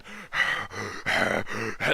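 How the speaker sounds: mimicked heavy huffing breaths; imitated exhausted panting. Storyteller imitating the third guy showing up smoked, exhausted panting. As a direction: huff and puff like someone totally smoked. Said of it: "already smoked when he got to us".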